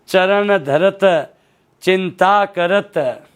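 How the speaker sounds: very slow, each syllable drawn out; slow recitation. Shown as a demonstration. Teacher reciting a verse line to show a word, very slow. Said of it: "अगला देखो चरण".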